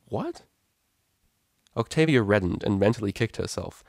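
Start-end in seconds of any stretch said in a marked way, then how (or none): 0.1-0.4 high, soft baffled question. Acted. Vinyl baffled by Octavia's question, soft. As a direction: caught completely off guard.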